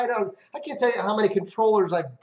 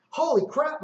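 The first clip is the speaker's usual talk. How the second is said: surprised; 10 semitones higher than usual. Retold or imitated. Reacting to second place.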